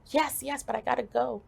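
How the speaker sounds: high, urgent reply; hurried brush-off. Retold or imitated. To people trying to grab her, hurried brush-off.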